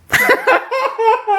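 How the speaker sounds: high-pitched burst of laughter; cracking up at own joke. Real, not acted.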